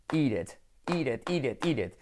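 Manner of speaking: "eated" sounding like "ired", T flapped between vowels; soft R for T. Shown as a demonstration. Teacher drilling eat it for Spanish learners, soft tapped R. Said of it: "se convierten en una R suave".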